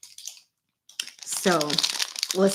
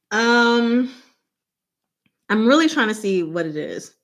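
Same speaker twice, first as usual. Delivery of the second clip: ordinary delivery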